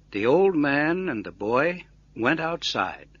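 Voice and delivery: ordinary delivery, nothing marked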